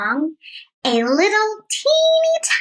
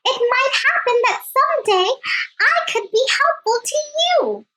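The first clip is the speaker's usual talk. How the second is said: high, fast, squeaky plea; pleading mouse; 10 semitones higher, faster than usual. Acted. Mouse begging the lion to let him go, high squeak. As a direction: beg in a tiny trembling mouse voice. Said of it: "the mouse pleaded".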